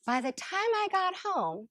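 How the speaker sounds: ordinary delivery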